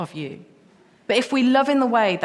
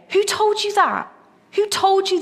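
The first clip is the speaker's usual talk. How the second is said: preaching challenge; 10 semitones higher than usual. Real, not acted. Preacher asking his congregation directly, in his own voice, pitched high. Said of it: "that's what I'm saying to you today".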